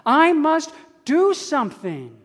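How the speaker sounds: ordinary talk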